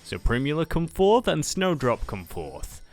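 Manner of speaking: normal talking voice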